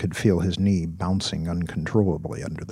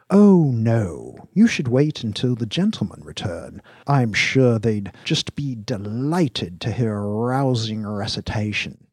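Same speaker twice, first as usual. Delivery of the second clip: smooth host with faint amusement; amused, unruffled boss; 4 semitones higher, 4 dB louder than usual. Acted. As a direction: humour the young man with leisurely politeness. Voices Dax, faintly amused by Virgil.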